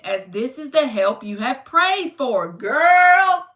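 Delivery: reads the card's message out with rising sass; an oracle card read aloud with flair. Acted. Reading the card meaning aloud.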